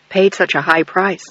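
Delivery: ordinary delivery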